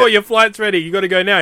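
a lightly voiced rowdy mate calling him to leave. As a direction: hail him like rowdy mates at the bar. Party mates call him away.

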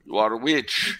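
lightly hammed Amazonian accent accusation; mock Amazonian accent. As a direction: play a witch accuser in a made-up Amazonian accent. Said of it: "if that was an Amazonian accent".

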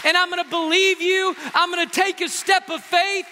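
high, impassioned preacher's cry; preaching at full force. Acted. Preacher pleading before the congregation, impassioned. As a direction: pour it out as a bold vow to the Lord.

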